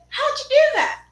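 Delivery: very high, loud astonished question; hammed-up high-pitched disbelief; imitated amazement. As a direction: mimic a girl squealing in amazement. Retold girl's amazed question.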